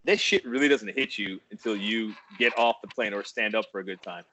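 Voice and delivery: ordinary talk, nothing marked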